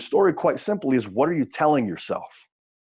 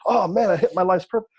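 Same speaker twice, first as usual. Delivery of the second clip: fired-up wrestler; 6 semitones higher than usual. Retold or imitated. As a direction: play the client hitting his breakthrough.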